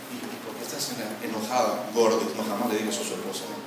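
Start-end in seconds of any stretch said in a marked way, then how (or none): 0.1-2.2 snippy comeback. Pretend. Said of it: "esta es la respuesta".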